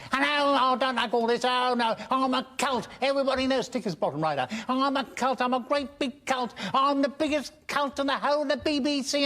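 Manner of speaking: high, hammed-up showbiz voice, imitating a preening star; lightly camp impression of a vain comedian; Kenneth Williams. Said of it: "Kenneth wouldn't go".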